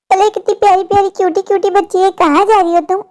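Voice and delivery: normal talking voice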